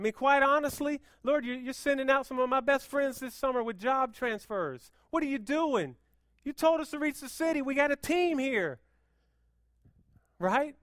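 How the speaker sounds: loud, high, fast-pushing preacher; peak preaching. Acted. Pastor telling the church about friends leaving, loud high preaching. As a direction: preach it hard, almost shouting.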